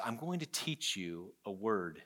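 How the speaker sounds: father turning to mercy softly; gentle dad. Retold or imitated. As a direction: ease it in like a kind dad. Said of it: "He says".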